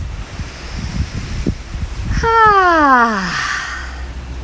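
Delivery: long loud "ah" like the wind; windy "ah" sigh. Shown as a demonstration. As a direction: sigh it out like wind, with real sound. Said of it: "sound in it, like the wind".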